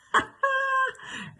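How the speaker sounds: high, hammed-up titter; high comic laugh. Gossip delighted by her own retort, high comic laugh. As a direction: laugh shrilly at your own joke.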